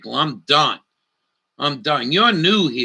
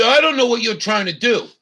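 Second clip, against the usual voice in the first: mock snotty clerk, higher and a bit quick; 9 semitones higher, faster than usual. Retelling the rude store clerk, in a snotty tone. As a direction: put on a snotty sneer. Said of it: "Real snotty like".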